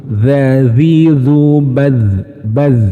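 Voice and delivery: slow drawn-out dzazizu drill; slow articulation drill; dzal drill. Shown as a demonstration. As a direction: stretch the dzal sound slowly. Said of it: "Sebaiknya seperti apa?".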